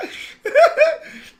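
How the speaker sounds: high squealing laugh, words strangled by it; shrieking laughter. Real, not acted. Cracking up at the size of her backside. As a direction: howl it out through laughter.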